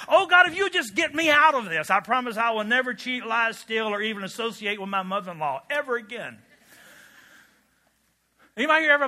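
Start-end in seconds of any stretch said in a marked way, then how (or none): 0.1-6.4 bargaining prayer. Acted. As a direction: beg to be let off, hammed up. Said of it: "Ever prayed that prayer?".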